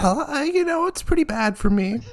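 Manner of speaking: high-pitched wife voice; high sick voice. Acted. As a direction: lift the voice up high, a touch sick. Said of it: "doesn't sound like you've got it as bad".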